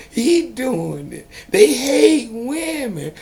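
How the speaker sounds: high, loud mock voice of the critics; mocking what people say. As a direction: parody the people who blame the water. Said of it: "most people gonna say".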